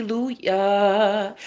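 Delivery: slow, stretched-out praise; drawn-out hallelujah. Real, not acted. Woman celebrating her superhero man, drawn-out praise. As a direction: draw out each syllable in celebration.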